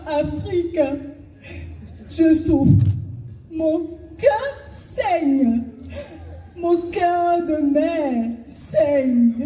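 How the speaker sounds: high, slow grieving mother Africa; heartrending voice. Acted. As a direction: plead like a suffering mother. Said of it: "avec une voix déchirante, pas des pleurs".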